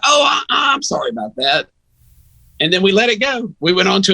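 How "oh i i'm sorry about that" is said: mock apology. Coach apologizing, voiced in story.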